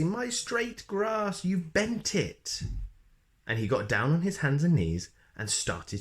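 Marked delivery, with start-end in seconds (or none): 0.0-2.6 high, slow fussy lament; fussy wail. Acted. Mister Fussy lamenting his bent grass, high and slow. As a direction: stretch it out in a high, pained whine. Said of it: "cried Mister Fussy".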